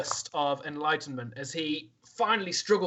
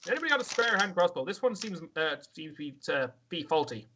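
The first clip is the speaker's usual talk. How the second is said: goblin grumble; 4 semitones higher than usual. Acted. Goblin griping to the party about his faulty crossbow. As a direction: grumble about a broken crossbow in a high put-on voice.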